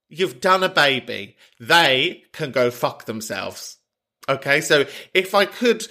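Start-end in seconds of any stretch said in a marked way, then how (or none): 1.6-3.8 slow, deliberate, in a normal register; measured, emphatic verdict; firm insistence. Real, not acted. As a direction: spell it out slowly for a friend. Repeats it slowly, firmly.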